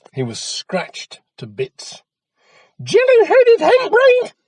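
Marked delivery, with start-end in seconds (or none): 2.8-4.3 very high, very loud shriek; yelled insult. A narrator's reading. Mother yelling at scratched Jack, shrieking. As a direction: scream the insult. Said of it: "yelled his mother".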